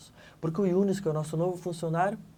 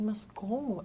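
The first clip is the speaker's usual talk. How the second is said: hushed, breathless mas como; whispered disbelief; 5 semitones higher, 4 dB quieter, much slower than usual. Retold or imitated. To his boss, a stunned whisper. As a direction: whisper it in shock. Stunned reply to boss's demand.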